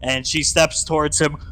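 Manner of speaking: ordinary talk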